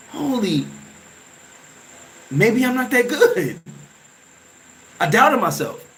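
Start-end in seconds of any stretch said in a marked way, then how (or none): 0.1-3.6 high, slow, laughing through the doubt; laughing self-doubt. Retold or imitated. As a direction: chuckle at your own nerves. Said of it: "I look at the back page and I go".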